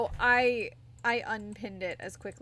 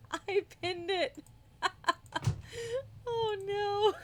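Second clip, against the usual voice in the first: laughing; 11 semitones higher than usual. Real, not acted. Laughing at her own mistake.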